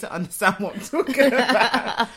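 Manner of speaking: amused complaint through giggles; laughing. Real, not acted. Explaining that viewers need the visuals, laughing. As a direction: keep talking while laughing. Giggling over friend's arm gestures.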